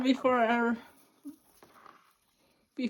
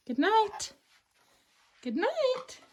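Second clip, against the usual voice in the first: high sing-song goodnight; 9 semitones higher, slower than usual. Real, not acted. Parent saying goodnight to baby.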